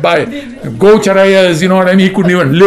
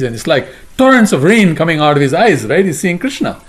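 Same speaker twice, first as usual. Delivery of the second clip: normal talking voice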